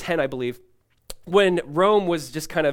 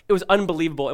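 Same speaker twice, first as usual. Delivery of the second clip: ordinary talk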